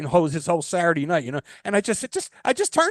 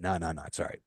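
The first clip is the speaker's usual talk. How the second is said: polite refusal; 10 semitones lower, 11 dB quieter than usual. Retold or imitated. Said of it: "Very politely".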